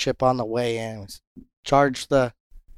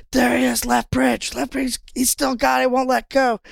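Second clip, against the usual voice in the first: much higher, mock-frantic calls; excited shouting; 12 semitones higher, 3 dB louder than usual. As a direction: imitate a mate urgently calling out a fish. Acted mate excited over supposed fish.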